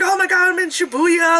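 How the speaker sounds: high, excited gush about being in Shibuya; mock giddy tourist squeal. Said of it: "all excited to, like, be in Japan".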